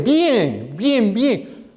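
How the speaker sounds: high, sing-song "doing fine" act; mock-breezy, swooping reassurance; put-on cheerfulness. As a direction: cover feeling awful with an overdone upbeat answer. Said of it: "aunque anda un trapo por el piso".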